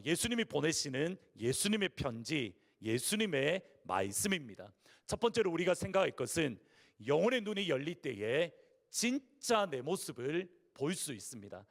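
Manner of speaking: ordinary talk, nothing marked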